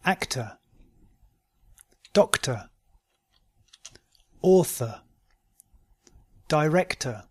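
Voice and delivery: "Actor" as "ac-tuh", R silent; British model words, O R said "uh" as in "Doctor"; non-rhotic schwa ending. Shown as a demonstration. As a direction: end "Author" on a short schwa, no R. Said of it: "words ending in O R".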